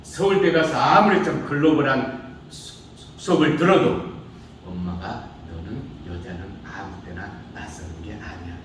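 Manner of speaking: ordinary talk, nothing marked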